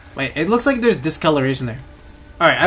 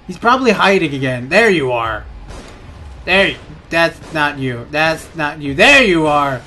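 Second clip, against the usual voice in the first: usual speaking voice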